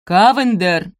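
loud call across the yard; shout. A narrator's reading. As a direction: yell his name from afar. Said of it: "послышался крик".

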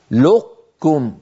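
"لقكم" closed on q, released as k; very slowly hold the q in "لقكم", no bounce; drawn-out demo of "لقكم" with heavy q into k; partial assimilation. Shown as a demonstration. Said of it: "يطبق على قاف ثم يجافي".

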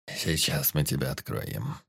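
low, slow, soft menace; quiet hoarse murmur. A narrator's reading. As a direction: murmur it quietly like a calm butcher. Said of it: "тихонько произнёс дед посаженным голосом".

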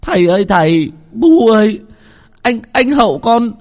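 high, tearful shouting, dragged out at the end; screamed cry. A narrator's reading. Boy telling his parents of his brother's death, screaming. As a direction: scream the terrible news to mum and dad. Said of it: "bố tôi nói như là hét lên".

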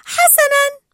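very high, loud, gleeful; happy agreement. A narrator's reading. Dory forgetting the warning and agreeing, happily. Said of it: "وقالت في سعادة".

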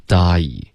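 slow "die" with ah sliding to ee; model gliding die. Shown as a demonstration. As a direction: demonstrate the diphthong in "die". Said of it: "啊，一的滑动".

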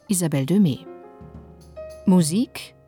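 normal talking voice

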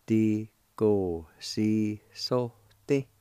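slow, each syllable of "Di go si so ti" held apart; slow demo. Shown as a demonstration. Teacher repeating the Cherokee word for lecture, slowly. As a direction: sound out "Di go si so ti" so students can follow each piece. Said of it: "it's pronounced like this".

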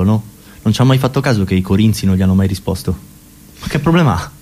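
usual speaking voice

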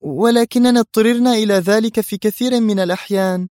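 startled, high-pitched objection; astonished. A narrator's reading. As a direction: object in surprise. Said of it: "قالت في دهشة".

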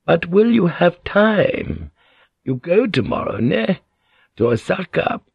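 a slow, sugared opening question; honeyed. A narrator's reading. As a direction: ask sweetly, setting a trap. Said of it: "in her most honeyed voice".

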